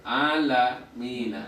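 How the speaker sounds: "Alamina" drawn out slowly; slow syllables. Shown as a demonstration. Teacher sounding out a word for the class, slow.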